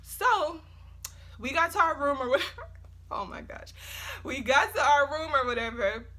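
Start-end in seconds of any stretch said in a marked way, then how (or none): 1.4-3.7 laughing. Real, not acted. Laughing through storytime.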